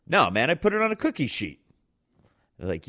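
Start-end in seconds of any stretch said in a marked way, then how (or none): 0.1-1.6 high-pitched, cocky retold reply; smug comeback. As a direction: act out thinking you're so clever. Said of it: "thinking I'm all clever as shit".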